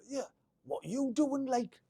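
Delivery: high, cheeky Geordie lad taunt; Geordie accent. Retold or imitated. Local kids on the bus taunting a cadet, mock Geordie accent. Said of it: "I'm hoping that was a Geordie accent".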